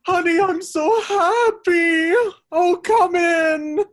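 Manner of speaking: shrill clip, very high, slowed; high played clip.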